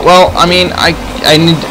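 normal talking voice

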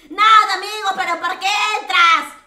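real yell, high and very loud; frustrated shouting. Real, not acted. Host to a player who joined but won't talk, frustrated shouting. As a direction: shout at them in exasperation. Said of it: "lo siento por gritar".

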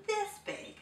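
high, excited cry; cried out. A narrator's reading. Mouse showing the frog, crying out high. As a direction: throw the arms wide and cry it out. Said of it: "he cried".

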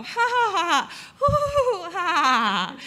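forced, high ha ha ha; exaggerated, high fake laugh; fake laughter. Shown as a demonstration. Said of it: "the fake laughter".

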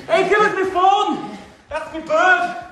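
high, loud protest; urgent shout. Acted. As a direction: demand it back mid-scuffle. Struggling to get his phone back.

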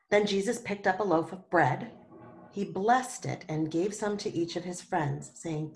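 ordinary delivery, nothing marked

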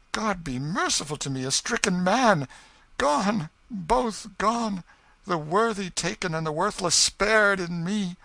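high anguished lament; grieving brother, loud and high; grief-stricken cry. A narrator's reading. Grief at news of deaths.

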